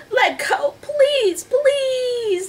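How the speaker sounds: very high, wailing plea; shouted plea. A narrator's reading. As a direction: beg loudly, drawing out each please. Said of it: "Annie shouted at the octopus".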